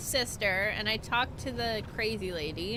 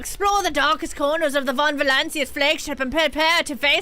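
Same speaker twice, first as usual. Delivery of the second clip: announcer read; 3 semitones higher, 6 dB louder than usual. Pretend. Streamer mock-reads the game's expansion ad, booming. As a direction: sell the expansion like an epic trailer.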